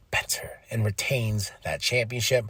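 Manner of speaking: whispered; whispered reveal. Real, not acted. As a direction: drop to a breathy whisper. Whispered ending.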